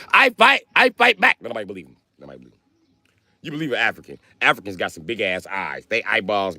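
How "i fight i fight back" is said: high clipped mock Chinese accent. Imitating Chinese people, high mock Chinese accent. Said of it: "When Chinese people be like".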